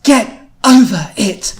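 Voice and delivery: slow, loud, high and emphatic order; very firm. A narrator's reading. Louise to the two ninjas, extra firm. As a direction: stretch each word out with heavy firmness. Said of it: "do that in a more firm Louise voice".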